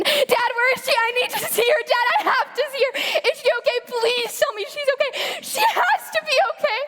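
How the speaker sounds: high, frantic, tearful begging; desperate pleading. Acted. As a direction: beg for her to be okay.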